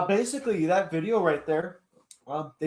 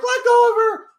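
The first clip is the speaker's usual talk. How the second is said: high, loud mock command; imitated police shout; 9 dB louder than usual. Cops ordering a girl to release him, imitated shout. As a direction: call it out urgently like an officer intervening. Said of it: "the cops are like".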